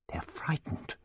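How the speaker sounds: hushed, breathless realization; awed whisper; frightened whisper. Acted. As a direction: whisper it as the truth dawns. Vicky realises the monsters fear.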